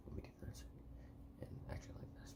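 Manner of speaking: whispered to himself; hushed voice. Real, not acted. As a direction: mutter it under your breath. Muttering to self while adjusting for the camera.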